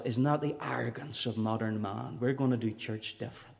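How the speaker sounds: muttering to himself. Retold or imitated. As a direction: mutter it under your breath, scornfully. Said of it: "I thought to myself".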